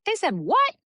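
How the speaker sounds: high, loud squeak of disbelief; shocked disbelief. Retold or imitated. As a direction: squeal out the shock you keep inside. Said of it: "Internally, I'm like".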